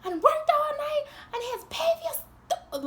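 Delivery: mock tired yell; retold yelling. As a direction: shout it in exhausted fury. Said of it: "I was like".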